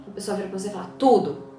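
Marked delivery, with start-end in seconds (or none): 1.0-1.5 curt sulky reply. Retold or imitated. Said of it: "Fecha a cara".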